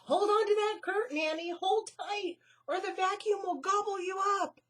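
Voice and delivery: high, fast, loud warning; urgent. Acted. Melissa urging Annie to cling to the curtain. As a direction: hurry her to hold tight.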